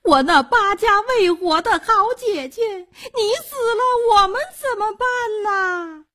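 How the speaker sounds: very high, theatrical mourning howl; fake dry wailing. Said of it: "干嚎着".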